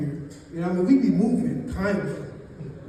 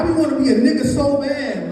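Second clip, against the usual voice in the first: loud emphatic; 4 semitones higher, 7 dB louder than usual. Real, not acted.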